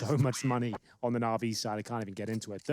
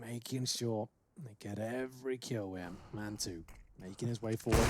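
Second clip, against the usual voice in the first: voiceless, strained whisper; 3 semitones lower, 9 dB quieter than usual. Real, not acted. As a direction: whisper with a failing voice. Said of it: "You guys really straining her there".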